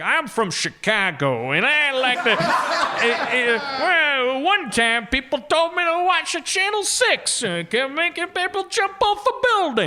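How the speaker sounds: put-on a goofy Chicago accent, tripping over words; mock Chicago accent. Podcast guest imitated for friends, a mock Chicago accent. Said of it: "sometimes he does it like".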